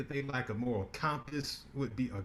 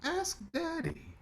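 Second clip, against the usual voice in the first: teasing; 4 dB quieter, slower than usual. Acted. Teasing about her father.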